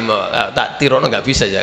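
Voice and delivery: ordinary delivery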